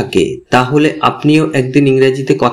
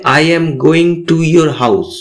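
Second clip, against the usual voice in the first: very slow, each word spaced out for learners; teacher says it slowly so students can copy; slow English model sentence; much slower than usual. Shown as a demonstration. Teacher models the English slowly for beginners. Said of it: "তাহলে হবে".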